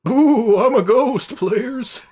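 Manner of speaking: high, loud hammed-up ghost wail; playful mock ghost. Player joking to the others at the name prompt, mock ghost voice. Spooky joke answering game host.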